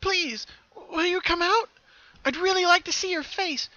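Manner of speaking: Pinkie begging the hidden pony to appear, pleading. Acted. Pinkie pleads to see her.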